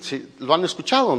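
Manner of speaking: ordinary talk, nothing marked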